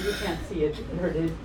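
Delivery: ordinary delivery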